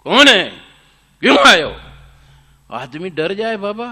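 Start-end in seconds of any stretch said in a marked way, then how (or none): 0.0-1.8 loud menacing growl at the visitor; growled like a lion. Acted. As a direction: roar it out like a caged beast. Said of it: "घुर्राया शेर की तरह".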